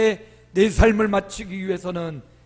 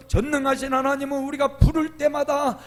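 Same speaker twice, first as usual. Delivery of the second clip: ordinary talk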